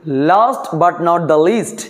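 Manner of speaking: each word drawn out slowly; slow teacher dictation; slow, weighty phrase. Shown as a demonstration. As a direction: stretch it out to show importance. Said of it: "কি বললাম".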